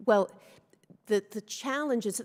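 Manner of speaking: usual speaking voice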